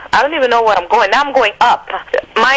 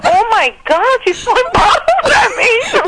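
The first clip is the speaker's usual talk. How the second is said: higher, laughing mock panic; laughing alarm; 13 semitones higher, slower than usual. [name] recalling bottles flying at her, laughing alarm. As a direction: retell the panic through laughter.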